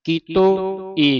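ਕੀਤੋ ਈ very slow, the final "ee" set apart; separated syllables. Shown as a demonstration. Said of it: "ਈੜੀ ਸੰਬੰਧਕ ਲਾਉਣੀ ਹੈ".

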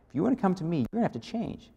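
a hushed, firm voice of God; soft voice of God. Retold or imitated. God's words voiced softly.

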